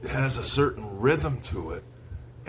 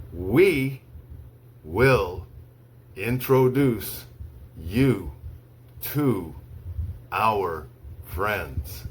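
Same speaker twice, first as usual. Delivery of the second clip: slow, one word at a time with long pauses; slow dictation; much slower than usual. Shown as a demonstration. Teacher reads to students for dictation, slow, word by word. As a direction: read it word by word so they can write. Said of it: "I'll say each sentence slowly three times".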